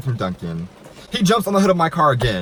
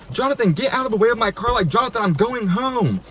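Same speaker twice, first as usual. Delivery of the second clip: high-pitched exasperated protest; mock yell without extra volume; retold exasperation; 9 semitones higher than usual. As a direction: act out being fed up with an ex. Retold yelling at ex blocking car.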